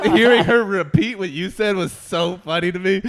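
laughing. Real, not acted. Laughing while retelling.

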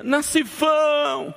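long, high call across the hall; calling out from afar. Retold or imitated. Big church brother calling the pastor down the hall, slow high call. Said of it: "de lá ele grita".

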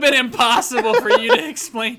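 high, loud, cracking up; laughing exclamation. Real, not acted. Friend reacts to absurd living room pictures, laughing loudly. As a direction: blurt it out through real laughter.

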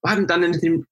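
high, pleading retold question; mock-timid junior cook answering back; acted innocence. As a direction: ask innocently, pitch lifted well up. Acted innocent reply to chef.

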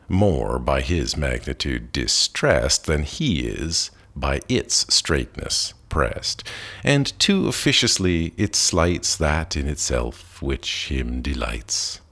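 ordinary delivery, nothing marked

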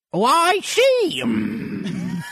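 high shrill parrot squawk; griffin parrot voice. Acted. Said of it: "a parrot voice".

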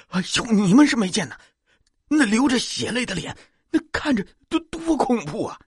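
reliving a fright for listeners; horrified exclamation. A narrator's reading. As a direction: terror in the voice. Narrator reliving terror.

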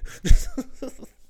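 high, loud burst of laughter; laughing. Real, not acted. Laughs recalling friend.

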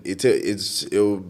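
normal talking voice

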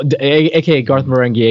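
ordinary talk

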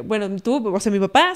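ordinary talk

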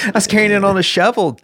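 talking fast through laughter; laughing. Real, not acted. Dad retelling carrying the crow, laughing fast. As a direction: push the words out while laughing.

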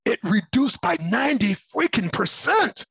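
ordinary talk, nothing marked

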